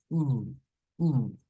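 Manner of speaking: uh as a held long "oo"; prolonged U sound. Shown as a demonstration. Teacher isolates Brasil's final sound, drawn out. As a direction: isolate the long U, slightly stretched. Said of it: "un poquito prolongado".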